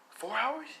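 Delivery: high-pitched, disbelieving question; incredulous surprise. Acted. Son questioning his dad's four hours, incredulous. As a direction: echo it back in high disbelief.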